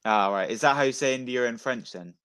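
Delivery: normal talking voice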